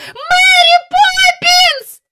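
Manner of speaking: slow, very high, loud scream; child's long cry for rescue; screamed call. Acted. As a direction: stretch the name into a desperate scream. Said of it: "закричала вона знову".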